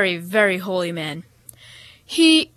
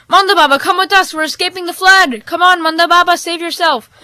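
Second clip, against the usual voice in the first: high, loud urging; urgent pleading; 8 semitones higher, 9 dB louder than usual. A narrator's reading. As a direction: beg him to come along. Rescuers urging from car.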